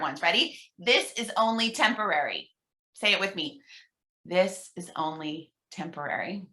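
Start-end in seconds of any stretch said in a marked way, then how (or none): 4.3-6.4 soft, lower hushed echo; softer repeat. Shown as a demonstration. Leader repeats the mantra softly for the group, hushed. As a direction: say it back quietly. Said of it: "I'll whisper it kind of in the second one".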